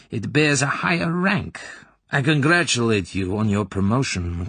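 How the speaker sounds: Colonel Klebb voice. A narrator's reading. Colonel giving the corporal an assignment, formal. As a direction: announce the honour like an official.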